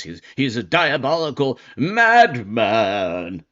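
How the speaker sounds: hammed-up, theatrical outrage; sarcastic, overdramatic indignation; mock melodrama. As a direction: put on a pompous, overwrought accuser, drawing out the final words. Mocking impression of indictment writers.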